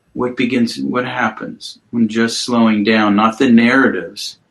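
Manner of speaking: guided mindfulness. Acted. Guides client through body awareness.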